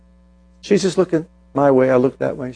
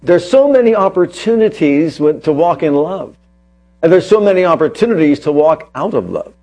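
raised, emphatic sermon voice, punching key words; preaching; 3 semitones higher, 3 dB louder than usual. Acted. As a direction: drive the point home to the congregation. Preacher builds his point.

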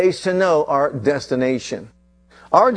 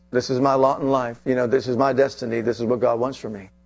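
softer, lower, trailing resignation; resigned defeat; 5 semitones lower, 5 dB quieter than usual. Acted. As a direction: sound like you've given up. Voicing resigned victim mentality.

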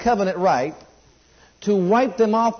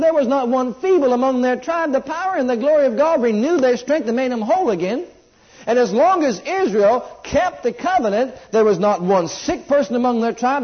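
raised, higher preaching cadence; preaching at full force; 6 semitones higher than usual. Acted. Preacher rousing his congregation, in full preaching voice. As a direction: preach it out to the whole church.

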